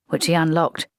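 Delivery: ordinary delivery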